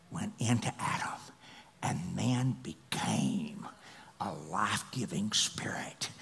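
soft, low whispered awe; hushed whisper. Acted. Preacher hushing the church at God's breath, whispering.

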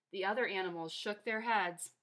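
ordinary delivery

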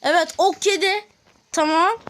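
ordinary delivery

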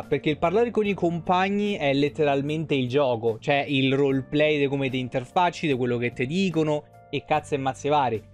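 ordinary talk, nothing marked

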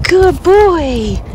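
high, doting pet-talk voice; cooing dog praise. Real, not acted. Dog mom praising her dog after a treat, cooing. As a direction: praise the dog in a lifted coo.

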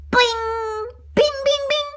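high, ringing "bing" noises; ping out a high, loud bell sound; idea chime. Acted.

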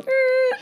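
very high mock buzzer "eh"; high-pitched noise of being cut off; imitated buzzer noise. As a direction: squeal a playful rejection noise. Imitating God vetoing a plan.

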